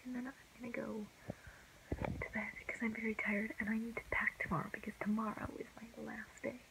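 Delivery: late-night hushed whisper. Real, not acted. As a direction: keep it hushed, others asleep.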